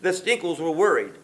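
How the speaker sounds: ordinary delivery, nothing marked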